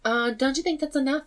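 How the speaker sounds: high, doubtful mom. Retold or imitated. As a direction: question it like a worried mom. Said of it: "when Mom was like".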